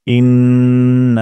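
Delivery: ইন্না with the না held one alif; slow recitation. Shown as a demonstration. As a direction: stretch the না of ইন্না. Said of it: "নাতে একালির টান দিব". (ইন্না, না এক আলিফ টেনে)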